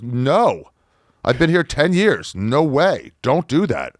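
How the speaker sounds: high, insistent warning; alarmed guide. Retold or imitated.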